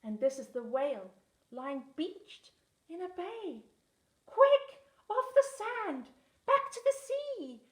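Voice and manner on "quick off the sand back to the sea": much higher and louder, urgent; urgent cry. A narrator's reading. Snail urging the beached whale, panicked shout. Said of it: "cried the snail".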